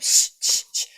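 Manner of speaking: imitated string of unvoiced shushes; repeated shushing. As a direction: shush the people around you, over and over. Hushing people during a talk.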